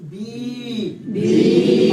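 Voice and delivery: بی sung long, then a loud breathy repeat; stretched vowel. Shown as a demonstration. Teacher showing students the long بی. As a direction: draw out بی as a teaching chant. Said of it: "آواز بڑھ جائے گی".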